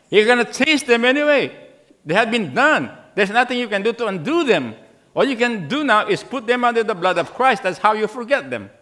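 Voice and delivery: full-force pulpit preaching; fervent preaching. Acted. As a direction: drive the point home from the pulpit.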